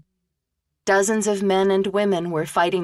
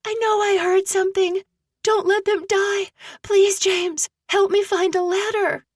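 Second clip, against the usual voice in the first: desperate pleading. Acted. Aggie pleading with James.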